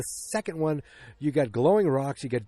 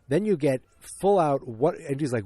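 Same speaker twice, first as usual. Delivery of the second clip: normal talking voice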